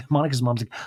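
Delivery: usual speaking voice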